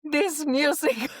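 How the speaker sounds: put-on old person voice. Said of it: "sound like an old person".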